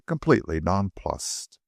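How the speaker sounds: usual speaking voice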